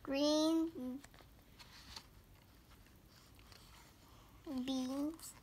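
slow sing-song storybook read-aloud; picture book reading. Acted. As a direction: read each page like a picture book for little kids.

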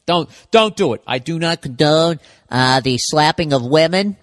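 hammed-up legal warning, half joking; funny voice. Comedian covering himself against legal trouble, hammed-up funny voice. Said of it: "And I said it in a funny voice".

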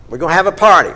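loud, bursting announcements; joyful proclamation. Acted. Father announcing his son's return, loud and overjoyed. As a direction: shout the good news to the house.

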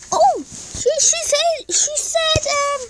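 high squealing excitement; excited squeal. Pretend. Kid squealing that the cat gave an answer. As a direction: squeal like the cat just spoke.

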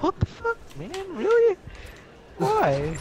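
squeaky high mock disbelief; mock outraged disbelief. Friend voiced reacting to an insult, high mock disbelief. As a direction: jump way up, outraged and baffled. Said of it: "you're gonna be like".

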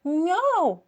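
high, loud desperate meow. A narrator's reading. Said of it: "закричала ще голосніше". (ще гучніше нявкання)

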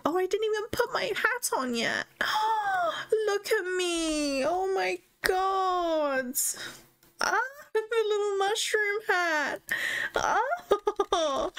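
very high, tearful delight; gasping, teary happy squeal; overjoyed squeal. Real, not acted. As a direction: squeal with joy close to tears. Overjoyed at her game hat.

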